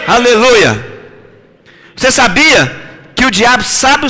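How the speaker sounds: full-voiced preacher exhorting; fiery preaching. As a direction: preach it out to the whole church. Preaching to the congregation.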